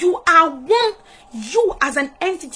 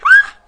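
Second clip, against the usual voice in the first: high, loud "ah!"; sudden piercing exclamation of awe; awed cry out; 13 dB louder than usual. Real, not acted. Overcome by God's intentionality.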